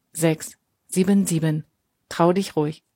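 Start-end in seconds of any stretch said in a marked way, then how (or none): none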